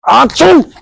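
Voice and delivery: loud fake sneeze; sneezing. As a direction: burst out a big sneeze. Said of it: "Yeah, I just sneezed on you".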